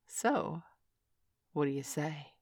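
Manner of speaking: ordinary delivery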